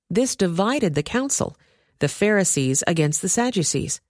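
ordinary delivery

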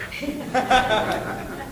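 breathy sneaky giggle; sly soft giggle. Retold or imitated. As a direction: snicker under your breath. Sister laughs slyly.

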